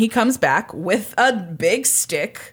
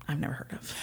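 whisper; 4 semitones lower, 15 dB quieter, faster than usual. Real, not acted. Whispered aside confession.

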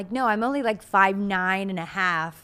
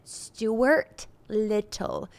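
slow, careful Stuart Little, each syllable dragged out; struggling with the name; much slower than usual. Shown as a demonstration. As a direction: ease through "Stuart Little" very slowly. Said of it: "it's my speech impediment".

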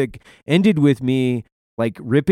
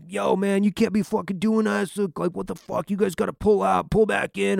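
flaming mad; 8 semitones higher than usual. Retold or imitated. Irate fuel guy chewing out the gun truck crew, mock-angry. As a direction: imitate an irate man in a high voice. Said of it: "he is flaming mad".

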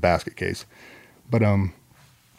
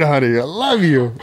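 laughing declaration; 4 semitones higher than usual. Retold or imitated. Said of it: "Yelling more out loud".

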